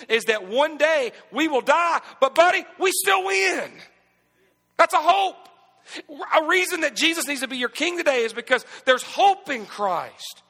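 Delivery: high, loud, rising preacher's cry; preaching at full force. Acted. Pastor preaching to his congregation, full force. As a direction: build it up and preach it hard.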